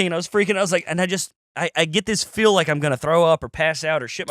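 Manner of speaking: ordinary delivery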